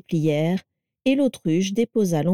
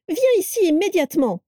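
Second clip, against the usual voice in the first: high-pitched angry order; roaring command; 11 semitones higher than usual. A narrator's reading. Carla orders her husband back into the room, roaring. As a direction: order him over, voice leaping high. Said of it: "rugit Carla".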